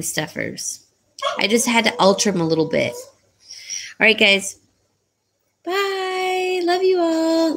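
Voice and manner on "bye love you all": high, drawn-out goodbye; affectionate sign-off. Real, not acted. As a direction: sing out a loving farewell.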